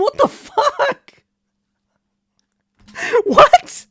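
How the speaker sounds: high, laughing what the fuck; cracking up in disbelief, high and loud; a laughing shriek of shock. Real, not acted.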